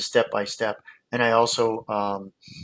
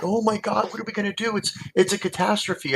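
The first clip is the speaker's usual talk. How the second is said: high, mock panicked voice; mock panic, pitched much higher; panicked son-in-law; 10 semitones higher than usual. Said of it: "He's like".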